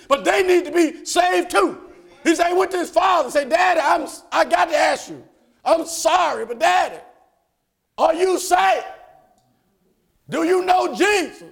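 high, loud sermon storytelling; full-force preaching. Acted. Preacher telling the church of a saved son, high shouted preaching.

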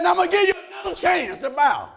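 shouted royal threat, pitched high; angry king. Retold or imitated. The furious king shouting at the Hebrew boys, angry shout. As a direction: bark the king's ultimatum.

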